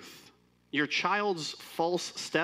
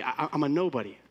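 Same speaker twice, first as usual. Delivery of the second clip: ordinary talk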